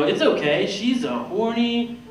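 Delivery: landing what he thinks is a pickup line; smooth line. Retold or imitated. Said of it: "I go".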